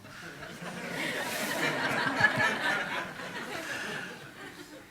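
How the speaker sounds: high, long burst of laughter; real, open laugh; hearty laugh. Real, not acted. Teacher laughing with the audience.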